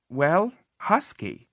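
ordinary delivery, nothing marked

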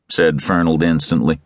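ordinary delivery, nothing marked

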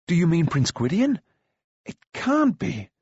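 amazed disbelief. A narrator's reading. Taran hears the prince is coming, astonished disbelief. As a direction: pitch leaping high in astonishment.

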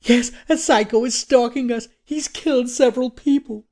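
put-on very high, hysterical girl's voice. A narrator's reading. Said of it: "Jenna broke into hysteria".

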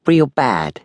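lightly voiced American-Cockney tough; Americanized Cockney. A narrator's reading. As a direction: chime in with a phony American-Cockney twang. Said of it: "the voice of an Americanized Cockney".

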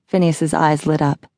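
ordinary delivery, nothing marked

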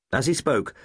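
ordinary delivery, nothing marked

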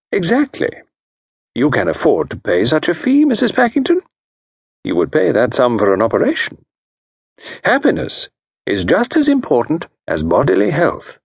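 diagnose her case with calm authority; a genteel consultant voice; Parker Pyne. Acted.

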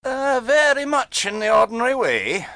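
high-pitched, measured answer; grave reply. A narrator's reading. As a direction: answer with mock-solemn gravity. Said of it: "was the grave reply".

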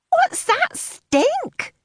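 very high, slow and drawn out; disgusted complaint. A narrator's reading. Teen complains at the table, high disgusted whine.

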